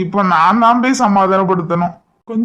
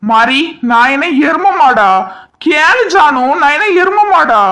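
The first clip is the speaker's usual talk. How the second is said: indignant, climbing higher with each question; mock indignant; 6 semitones higher than usual. Husband appeals to his wife over the insult, mock indignant. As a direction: protest higher and higher in mock outrage.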